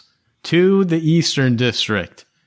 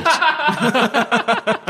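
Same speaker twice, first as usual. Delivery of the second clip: high giggle; 13 semitones higher than usual. Acted. GM laughs in merchant voice.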